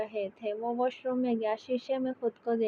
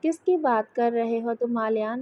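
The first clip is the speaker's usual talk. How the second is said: ordinary delivery, nothing marked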